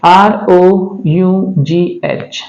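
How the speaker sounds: slow letter-by-letter spelling; आर ओ यू जी एच said letter by letter, slowly; spelled out slowly. Shown as a demonstration. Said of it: "पहला है".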